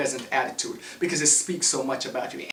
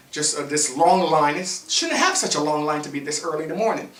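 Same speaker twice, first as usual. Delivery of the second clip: ordinary delivery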